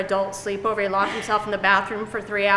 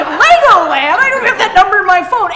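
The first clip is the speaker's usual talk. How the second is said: loud, high, incredulous protest; hammed-up outraged question; exasperated disbelief; 8 semitones higher, 11 dB louder than usual. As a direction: shout back at the stupid phone. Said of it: "You're like".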